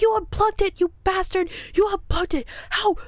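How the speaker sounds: high-pitched, accusing re-enacted outburst; going off, retold. Woman accusing partner of unplugging the light, retold outburst. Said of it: "I was just going off".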